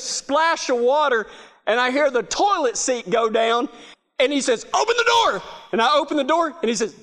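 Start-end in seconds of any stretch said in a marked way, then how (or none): 4.7-5.4 panicked call. Retold or imitated. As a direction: yell to be let out, half in panic. Fireman yelling from the bathroom.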